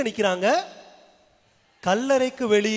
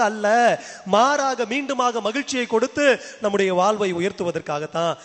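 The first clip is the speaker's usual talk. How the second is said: raised, insistent sermon cadence; forceful preaching; 3 semitones higher, 4 dB louder than usual. Real, not acted. Preacher assuring the congregation of God's purpose, forceful preaching. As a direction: drive each denial home to the congregation.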